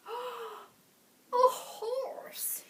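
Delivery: high, breathless delighted discovery; light up with a greedy grin; grinning delight. A narrator's reading. Bert spotting a horse in the cave, delighted. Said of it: "he grinned".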